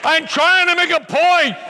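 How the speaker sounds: high, loud call to settle the laughing crowd; loud call to order. Real, not acted. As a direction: shout over the laughter to regain the room. Calming a laughing audience.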